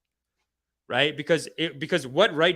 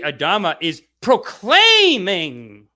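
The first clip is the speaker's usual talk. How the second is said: usual speaking voice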